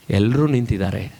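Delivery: low, grave acted reply; low-pitched, sorrowful quote; subdued concession. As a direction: concede it heavily, as the weeping violinist. Violinist's sorrowful answer.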